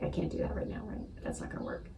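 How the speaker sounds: breathy near-whisper, dropping away; hushed inner excuse. Retold or imitated. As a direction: mutter the excuse under your breath.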